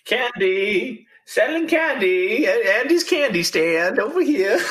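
high, sing-song street hawker calling out; candy vendor voice. Acted. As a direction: call out your candy like a carnival vendor. GM voices candy seller Andy.

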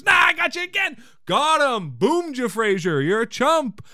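high, loud mock gloating; gloating taunt. Producer teasing Frasier after gaslighting him, gloating taunt. Roz gloats over her prank.

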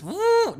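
high woo as goods slide away; imitated whoosh. Belt carrying goods down the end, a high woo. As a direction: mimic the second belt. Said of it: "it goes".

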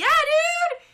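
high, loud cheer; excited shout. Real, not acted. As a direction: whoop it. Celebrating team win.

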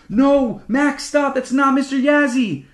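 high, loud warning cry; desperate shout. A narrator's reading. Older sibling warns Mac of the monster, desperate shout. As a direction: yell to stop him in time.

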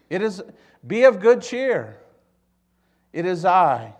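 loud, high proclamation; calling out. Acted. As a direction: proclaim it boldly across the storm. Jesus calling to disciples, first take.

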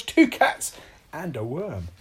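plain reading voice

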